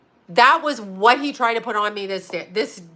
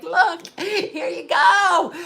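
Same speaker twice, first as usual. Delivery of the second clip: mock offer; 7 semitones higher, much slower than usual. Waxers offering to random people.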